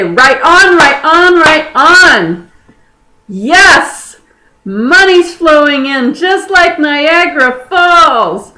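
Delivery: high, loud, exultant affirmations; jubilant cheering. Shown as a demonstration. Coach cheering on incoming money, jubilant.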